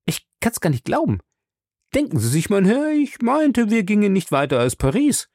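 high-pitched, talkative servant; chatty Passepartout, amazed. Acted. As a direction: gush about the trip you can hardly believe.

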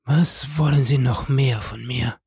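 rasping, croaked dying voice; death rattle. A narrator's reading. Dying wife confesses to her husband, with a rattle in her throat. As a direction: force it out through a rattling throat. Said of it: "mit einem Röcheln".

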